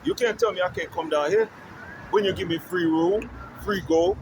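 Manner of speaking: ordinary delivery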